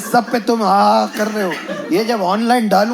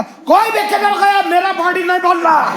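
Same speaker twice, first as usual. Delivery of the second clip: much higher ranting driver voice; mock driver shout; 10 semitones higher than usual. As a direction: insist like the angry driver.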